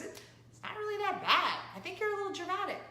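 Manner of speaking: put-on teen daughter. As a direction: play the unbothered teen. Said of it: "She goes".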